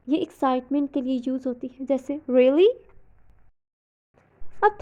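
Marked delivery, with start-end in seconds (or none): 2.3-2.7 "Really?" up then down, louder, surprised; rise-fall surprise. Shown as a demonstration. Teacher demos surprise with rise-fall tune. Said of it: "rise fall intonation".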